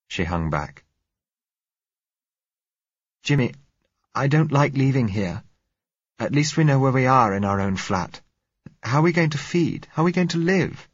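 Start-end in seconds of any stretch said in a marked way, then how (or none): 3.2-10.9 put-on higher, pleading scared girl's voice; frightened young girl. A narrator's reading. Blind girl begging Jimmy not to leave, frightened. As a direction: plead with him, fear rising.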